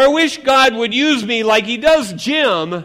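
high, loud envious outburst; loud complaint. Acted. Believer envying Jim, loud high complaint.

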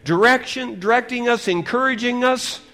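loud, high fervent preaching; full-force revival preaching. Acted. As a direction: preach it out to the hall.

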